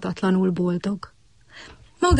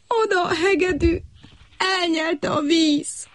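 mournful cricket; 9 semitones higher than usual. A narrator's reading. Cricket laments lost violin.

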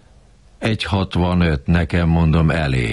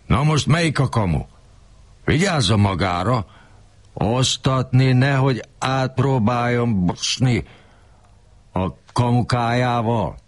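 mock attack; 8 semitones higher than usual. Boss badgering the narrator for news, mock angry rant. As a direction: boss ranting higher and pinched. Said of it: "rám támadt".

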